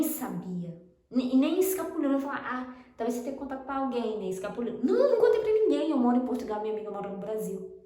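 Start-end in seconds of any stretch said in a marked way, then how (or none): 4.8-5.9 "Não, não contei pra ninguém" high and loud; emphatic denial. Real, not acted. Woman insisting she kept it secret, "Não, não contei pra ninguém" loud and emphatic. Insisting nobody was told.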